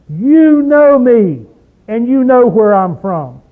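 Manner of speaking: loud preacher's exclamation of Jesus' words; exclaimed. Shown as a demonstration. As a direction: declare it loudly with an exclamation point. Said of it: "He exclaimed with an exclamation point".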